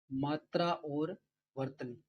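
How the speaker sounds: मात्रा और वर्तनी said slowly, word by word, for students to write; drawn-out teacher dictation of वर्तनी. Shown as a demonstration. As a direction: spell it out slowly so the class can copy मात्रा और वर्तनी.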